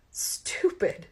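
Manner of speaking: breathy muttered stupid; self-mocking whisper. A narrator's reading. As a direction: scold yourself under your breath. Laughing at herself after fall.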